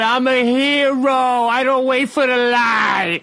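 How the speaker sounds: slow, high Boston shout; Boston accent. Retold or imitated. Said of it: "he just keeps yelling".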